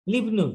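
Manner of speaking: লিবনু said as one word, li fused into ibnu with no break; joined pronunciation. Shown as a demonstration. Teacher shows students লিবনু said joined as one word. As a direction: run লিবনু together as a single word, not li then ibnu. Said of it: "একসাথে উচ্চারণ করবো".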